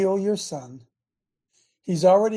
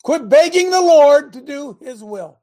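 loud, higher exhortation; preaching shout; 4 semitones higher, 10 dB louder than usual. Real, not acted. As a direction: hammer it at the congregation.

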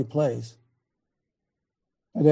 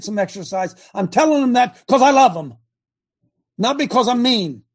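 raised, fast and loud, higher pitched; heated outburst; 7 semitones higher, 5 dB louder than usual. Retold or imitated. Patriarch to his wife, fired up and loud. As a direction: let it rip like a fed-up coach. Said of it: "I said".

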